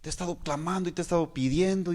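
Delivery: high, soft pleading imitation of a complaining believer; mock whiny prayer. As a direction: mimic someone whining at God, softly. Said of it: "Porque a veces decimos". (imitación de oración quejumbrosa, aguda y suave)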